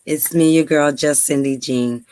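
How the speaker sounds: usual speaking voice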